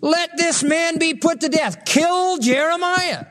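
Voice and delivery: high, drawn-out demand in the princes' voice; preacher acting out an urgent, slow appeal; princes' plea. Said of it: "the princes said to the king".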